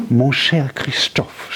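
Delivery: slow, defiant French greeting; drawn-out defiant endearment; wife defiantly greets her husband. Acted. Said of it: "sagte sie trotzig".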